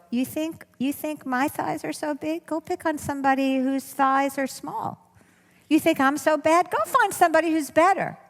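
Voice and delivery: high, teasing talk-back to the critic; mocking comeback. Shown as a demonstration. Speaker demonstrating how to answer the superego, high and mocking. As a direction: laugh it off, poke fun at the voice. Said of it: "the way you stop is with humor".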